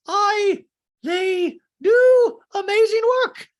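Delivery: very high, loud, stretched-out words; hammed-up indignant screech; mock outraged shriek. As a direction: bellow it shrilly like an offended patron. Doreen protests for her charity.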